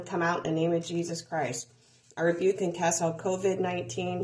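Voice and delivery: usual speaking voice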